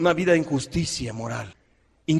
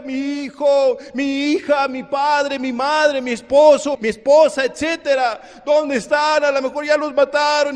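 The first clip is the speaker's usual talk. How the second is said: wailing families; 7 semitones higher than usual. Acted. Voicing wailing families after battle.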